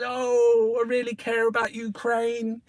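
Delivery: high whiny mock Boris voice. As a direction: whine it like a fake-sincere politician. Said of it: "He said".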